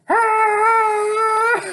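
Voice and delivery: very high, loud wail of despair; shrill howl of loss; anguished cry. Acted. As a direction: let out a long shrieking cry. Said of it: "he cried".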